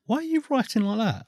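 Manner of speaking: high-pitched, incredulous question; puzzled partner. Retold or imitated.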